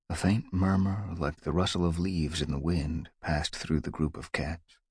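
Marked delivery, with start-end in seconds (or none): none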